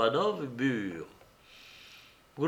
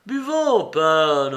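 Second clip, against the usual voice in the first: repeated cry; 2 semitones higher, 7 dB louder, slower than usual. A narrator's reading. Mitya hails the pacing pan, crying out. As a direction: hail him loudly across the room. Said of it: "répéta-t-il".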